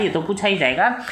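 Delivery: ordinary talk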